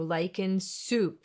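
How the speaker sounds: "soup" slowly with a round U; English U. Shown as a demonstration. Teacher models the oo of soup. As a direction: stretch the oo in "soup". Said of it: "form your mouth like you want to say".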